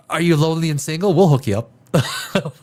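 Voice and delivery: ordinary talk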